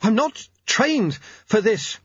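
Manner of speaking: high, slow, halting complaint; straining to talk while running; breathless protest. A narrator's reading. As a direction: push it out word by word, pitched high.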